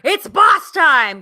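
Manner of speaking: high, loud, drawn-out announcer call; imitating an excited commentator; mock commentator catchphrase. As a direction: belt out a wrestling catchphrase slowly. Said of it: "Corey Graves going".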